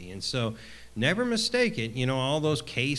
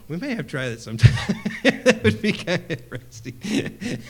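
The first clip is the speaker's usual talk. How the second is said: louder, laughing through the words; can't hold back a laugh at the idea; real laughter breaking into the line; 8 semitones higher, 7 dB louder than usual. Real, not acted. Laughing at own joke.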